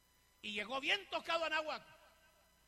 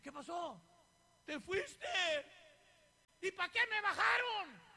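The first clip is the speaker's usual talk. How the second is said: high-pitched annoyed protest, slowing at the end; imitated indignation; 6 semitones higher, 5 dB louder, slower than usual. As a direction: act out someone crossly demanding an explanation. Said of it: "Bien enojado".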